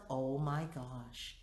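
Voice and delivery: soft, slow oh my gosh to herself; quiet realization. Retold or imitated. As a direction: breathe it out slowly.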